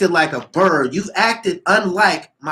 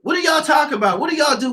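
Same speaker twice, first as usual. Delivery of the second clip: incredulous shout; 11 semitones higher than usual. Real, not acted. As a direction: shout it in disbelief. Incredulous rant about [name].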